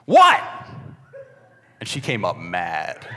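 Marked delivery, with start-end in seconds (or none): 0.1-0.4 loud, high-pitched shout down the stairs; sudden high holler of WHAT; yelled reply. Retold or imitated. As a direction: yell it back to someone downstairs. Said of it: "And so he yells down".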